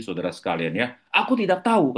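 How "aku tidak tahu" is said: high-pitched defiant exclamation; exclamation with raised intonation. Retold or imitated. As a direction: throw the denial back with the pitch jumping up. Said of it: "katanya, tanda seru".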